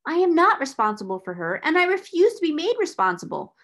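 loud, rising protest; angry refusal. Acted. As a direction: refuse it with mounting force. Angry quarrel over the debt.

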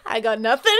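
helpless laughter through the words; laughing. Real, not acted. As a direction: give up, cracking up as you speak. Breaks up laughing after failed roast.

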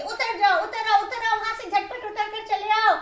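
high, loud urgent call; shouting. Acted. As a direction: yell at him to get down fast. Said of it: "सब एक साथ चिल्लाने लगे".

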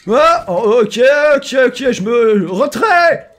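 high, loud alarm, slowing into a drawn-out shout; panicked retreat. Real, not acted. Player retreating from danger, panicked shouting. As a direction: shout while backing off in panic.

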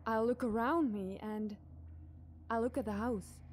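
ordinary delivery

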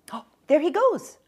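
put-on high, loud child spotting someone; excited child. Young girl spotting the little man, excited. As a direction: point him out eagerly.

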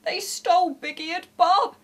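high, loud wail of upset; sobbing. A narrator's reading. Scotty dog reports his toys stolen, sobbing. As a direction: wail it tearfully. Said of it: "started to sob".

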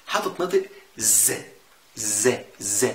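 ز held slow like a buzz. Shown as a demonstration. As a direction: buzz the ز like a droning headache. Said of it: "وتزن كده لما تصدع". (ز بزن طويل)

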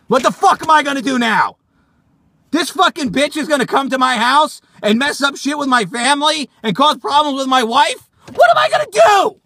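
high-pitched frantic rant building to a shout; frantic panic. Acted. Man fearing for his marriage, panicked. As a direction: wail it out, peaking at the end. Panics about stalker visiting home.

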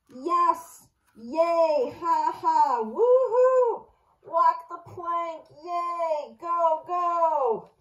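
gleeful crowd cheering on the plank walk; excited cheering. A narrator's reading. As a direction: cheer like the whole shelf is celebrating. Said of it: "Cheers erupted on the bookshelf".

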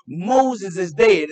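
each word slow and heavy, loud; solemn slow proclamation. Acted. Preacher pronouncing to the church that Moses is dead, slow and heavy. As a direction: drop it on them like a verdict. Voicing God telling Joshua.

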